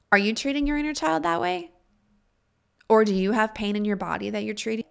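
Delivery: usual speaking voice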